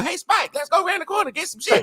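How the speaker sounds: a yappy little dog talking its pal into mischief. Pretend. Joking about tiny dogs, fast high yappy dog voice.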